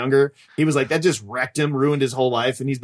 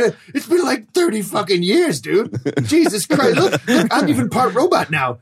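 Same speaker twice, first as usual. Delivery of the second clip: high-pitched gushing disbelief; hammed-up emotional outburst; overwhelmed reunion; 7 semitones higher than usual. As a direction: pour it out high to a long-lost friend. Acted reunion, Vader to droid.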